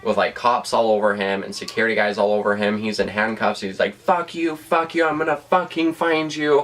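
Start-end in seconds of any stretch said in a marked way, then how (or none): 3.9-6.6 mock furious yell, much higher; imitating a cuffed man's raging threats; hostile yelling. Tim, handcuffed on the floor, threatening her with a high mock yell. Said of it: "being totally hostile towards me".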